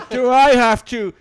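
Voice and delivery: laughing threat. Real, not acted. Threatening people outside, laughing.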